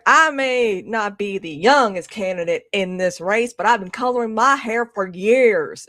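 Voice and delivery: slow, put-on Southern drawl; fake Southern accent. Candidate courting voters in South Carolina, mock Southern accent. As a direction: stretch it out in a phony Southern accent. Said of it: "fakest Southern accent".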